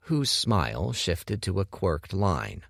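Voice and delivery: ordinary delivery, nothing marked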